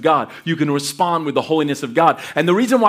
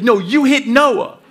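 slow, higher, louder correction; scolding dad; 9 semitones higher, 5 dB louder, slower than usual. Retold or imitated. Dad answering his daughter's false claim, slow mock scolding. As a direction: call out the child's fib with exasperated emphasis.

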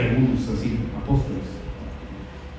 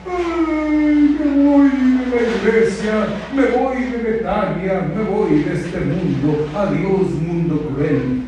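higher at first, slowing to a hammed-up sigh; melodramatic quitting; 5 semitones higher, slower than usual. A believer quitting church in a huff, mock melodrama. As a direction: ham up giving up on everything. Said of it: "se rajó y dijo".

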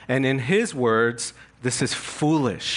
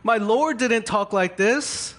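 exasperated Paul; 8 semitones higher than usual. Retold or imitated. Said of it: "It's like".

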